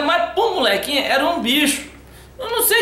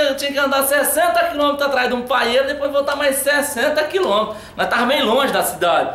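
ordinary talk

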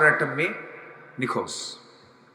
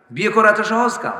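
usual speaking voice

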